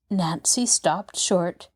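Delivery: usual speaking voice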